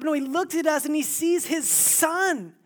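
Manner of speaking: loud, high preaching; preaching at full force. Acted. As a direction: drive the point home to the crowd.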